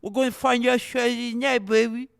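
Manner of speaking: high, loud hype impression; Max Holiday. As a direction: belt it out like a fighter's sound drop. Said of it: "did you actually think that was Max Holiday".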